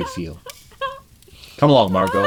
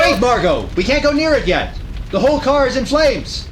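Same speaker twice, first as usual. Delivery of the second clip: urgent warning; 8 semitones higher, 4 dB louder than usual. Acted. Lamont holds Margot back.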